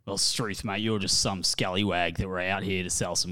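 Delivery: low, quiet broad Australian; mumble-free but low Aussie bloke; strong Aussie accent. Acted. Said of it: "Strong Aussie".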